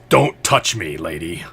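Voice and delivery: acted gruff refusal; indignant rebuff. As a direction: warn her off, firmly. Wounded Walrus rebuffs a helper.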